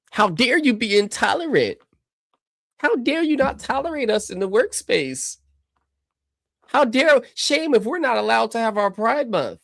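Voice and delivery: high mocking whiny voice; sarcastic high parody of an offended activist; mocking a homosexual. Streamer mocking a pundit's logic, in a high mocking voice. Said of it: "what if I was to mock a homosexual".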